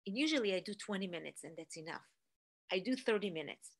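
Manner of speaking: ordinary delivery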